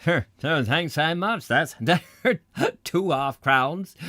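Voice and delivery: tipped farmer laughing; delighted laugh. Acted. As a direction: chuckle with glee over the coins.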